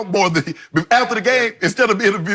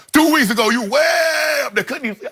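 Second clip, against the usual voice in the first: high-flying exclamation; 8 semitones higher than usual. Acted. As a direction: stretch it up high like a balloon aloft. Acts out balloon floating high.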